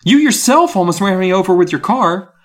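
very high, loud outraged rant; shrieking. A narrator's reading. Gary listing his ordeal to Miss Carmen, shrieking. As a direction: rail at full pitch. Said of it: "I shrieked".

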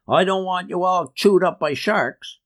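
high-pitched, mildly bellowed warning; bellowing. A narrator's reading. Uncle warning youngsters off his wharf, mild bellow. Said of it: "he would bellow".

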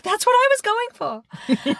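high-pitched mock author reaction; hammed-up imitation of a pleased author; imagined author quote.

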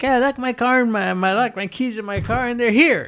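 high, far off cheesy demo voice; mocking fake ad guy. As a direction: ham up a fake commercial guy. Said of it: "The guy's like".

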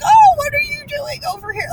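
high, startled guard voice; a hammed-up guard catching them off guard; mock surprised border guard.

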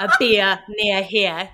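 slow, drawn-out Welsh Valleys accent. Shown as a demonstration. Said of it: "So you can say".

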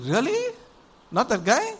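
high-pitched incredulous questioning; mock disbelief. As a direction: act out a puzzled "are you sure?". Samuel doubts God's rejection.

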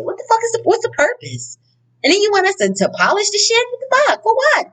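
high, incredulous ranting; can't believe the pointless rules, pitch way up; exasperated rant. Retold or imitated. Frustrated daughter ranting about mama's china, incredulous high voice.

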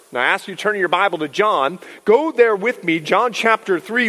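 usual speaking voice

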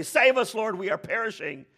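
loud, high cry for help; disciples' panicked plea. Retold or imitated. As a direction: act out desperate men shouting to wake him. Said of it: "they wake him up and they say".

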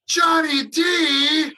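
long drawn-out happy shout; excited shout. Real, not acted. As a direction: call the name out joyfully, stretched. Greeting host on joining.

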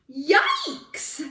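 high, loud startled squeal; lion yelp. A narrator's reading. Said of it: "yelped the lion".

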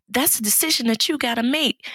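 ordinary talk

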